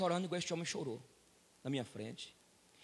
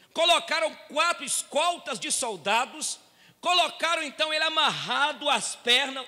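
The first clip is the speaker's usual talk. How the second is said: raised, ringing pulpit voice, higher and louder; fiery preaching; 9 semitones higher, 8 dB louder, slower than usual. Acted. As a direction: preach it out to the whole church. Pastor preaching at full force.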